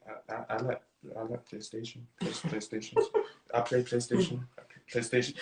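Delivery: very soft, low, halting voice; meek, low and stumbling; timid mumbling. Acted. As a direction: shrink down into an awkward, barely audible stammer. Acting his awkward younger self.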